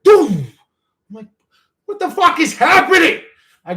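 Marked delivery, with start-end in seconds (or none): 0.0-0.5 loud, higher imitated thud of a falling jug; imitated crash. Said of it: "I hear". 1.8-3.2 startled yell. Retold or imitated. Retold shock at loud crash.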